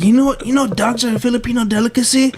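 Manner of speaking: high, mocking old man voice; threatening grandpa. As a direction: tease with a menacing grandpa act. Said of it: "He's like".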